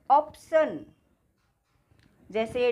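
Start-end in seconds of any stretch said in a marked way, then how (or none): 0.1-0.8 "ऑप्शन" opening on an open "aw"; AU vowel sound. Shown as a demonstration. Teacher giving an example of the AW sound, emphatic ऑप्शन. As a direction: stress the first vowel, "AWP-shun" for ऑप्शन. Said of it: "औ कब बोलेंगे इसको".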